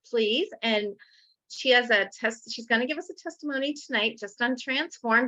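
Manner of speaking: ordinary talk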